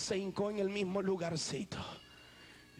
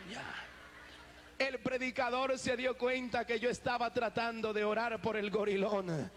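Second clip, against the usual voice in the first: ordinary talk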